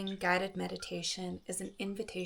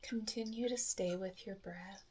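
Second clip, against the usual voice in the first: soft, unhurried meditation voice with long pauses; soft calm phrases, spaced out by silence; guided meditation; 9 dB quieter than usual. Acted. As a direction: guide listeners gently through each breath. Leads a guided breathing meditation.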